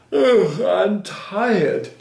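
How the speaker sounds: hammed-up weary wail, high and loud; sleepy, weepy duck. A narrator's reading. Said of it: "grew sleepy and weepy".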